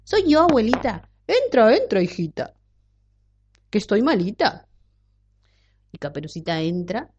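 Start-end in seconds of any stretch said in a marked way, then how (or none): 0.0-4.7 wolf faking grandma. A narrator's reading. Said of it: "fingiendo la voz de la abuelita".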